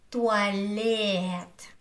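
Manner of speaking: "туалет" slowly, stretching the open e in лет; stressed е as э. Shown as a demonstration. Teacher correcting the sound, "туалет" slow with long open e. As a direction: linger on the e of "туалет", no y. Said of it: "I say".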